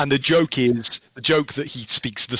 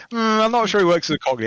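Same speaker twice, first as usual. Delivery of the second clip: doubtful colleague; 9 semitones higher, 4 dB louder than usual. Retold or imitated. Colleague's doubt voiced in retelling.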